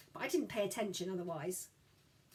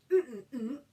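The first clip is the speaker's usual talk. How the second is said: closed-mouth hum; 2 semitones higher, 5 dB louder than usual. A narrator's reading. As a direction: mumble through shut lips. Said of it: "it came out".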